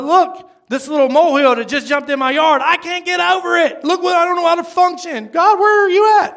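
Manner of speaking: high, overwrought wail building to a loud cry; mock panic. Believer crying out to God over a molehill, mock panicked. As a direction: blow it up into hammed-up desperation.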